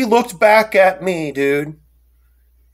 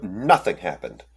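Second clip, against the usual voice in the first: very low, soft and slow; imitated eerie dismissal; 9 semitones lower, 5 dB quieter than usual. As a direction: wave it away in a low hush. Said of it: "she went, she went".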